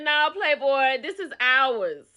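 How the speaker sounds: a lightly voiced boyfriend's defiant claim; defiant comeback. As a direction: lay down the law to the rival. Boyfriend answering the rival's boast.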